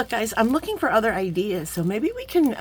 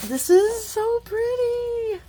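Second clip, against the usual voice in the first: much higher, slow cooing; 4 dB louder, much slower than usual. Real, not acted. Admiring a gift on camera, slow high coo. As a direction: coo over how pretty it is.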